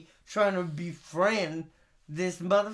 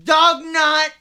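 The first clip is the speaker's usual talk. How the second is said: louder, higher gibberish tirade; mock angry rant; 5 semitones higher, 8 dB louder, slower than usual. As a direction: rant in hammed-up fury.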